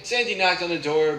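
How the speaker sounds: plain reading voice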